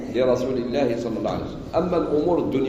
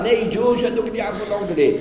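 ordinary talk, nothing marked

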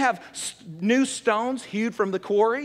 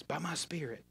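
very soft, lowered repeat as God; God speaking softly; 4 semitones lower, 14 dB quieter than usual. Retold or imitated. God repeating the promise to the believer, softly. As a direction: imitate God, nearly under the breath. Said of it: "God says".

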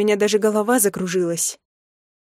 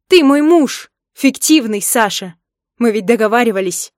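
upset yelling; 3 semitones higher, 7 dB louder than usual. A narrator's reading. Wife reminds her sham husband of their deal, upset yelling. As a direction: cry out the accusation.

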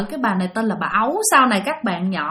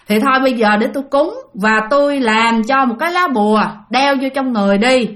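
ordinary talk, nothing marked

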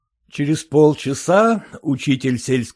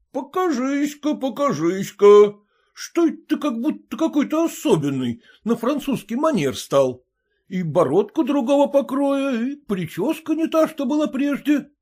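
заметно выше, медленно, с добродушной насмешкой; учитель с лукавым любопытством; голос учителя; 13 semitones higher than usual. Acted. Учитель разглядывает друга. (учитель подшучивает над другом)

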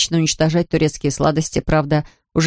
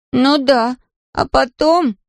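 put-on animated little boy; 7 semitones higher, much slower than usual. A narrator's reading. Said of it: "выразительно взмахнул руками Патрик".

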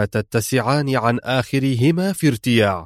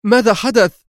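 much higher, louder anxious question; tense demand; 10 semitones higher, 4 dB louder than usual. A narrator's reading. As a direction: snap the question out in alarm. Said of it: "سألته في عصبية". (بعصبية وقلق)

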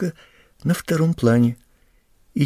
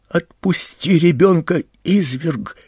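mother's raised, higher-pitched outcry; loud cry; 5 semitones higher, 4 dB louder than usual. A narrator's reading. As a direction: cry out loudly to make him let go. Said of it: "громко закричала мать". (громкий крик матери)

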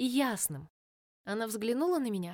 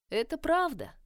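whispered; 6 semitones higher than usual. Acted. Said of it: "прошептала она".

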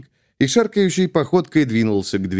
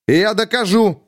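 heated exclamation, pitched higher; 11 semitones higher, 3 dB louder than usual. A narrator's reading. Captain defying the colonel, heated. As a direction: flare up in defiance. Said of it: "запальчиво воскликнул капитан".

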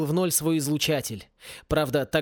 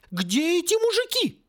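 very high-pitched wail; howling; 14 semitones higher than usual. A narrator's reading. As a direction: howl it out high in exasperation. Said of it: "взвыла Сьюзан".

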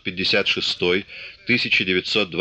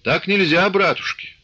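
shouted; 9 semitones higher than usual. A narrator's reading. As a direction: call it out across the dugout. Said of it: "крикнул".

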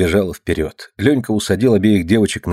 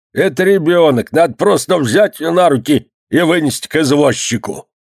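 higher, irritated insistence; angry; 7 semitones higher than usual. A narrator's reading. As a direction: argue crossly, voice pushed up. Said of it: "сердито говорил Никич". (сердито, повышенным тоном)

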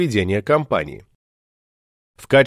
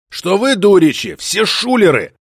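growled; 6 semitones higher than usual. Acted. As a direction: growl the accusation. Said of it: "прорычал он".

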